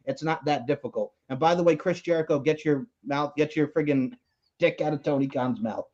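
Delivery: ordinary talk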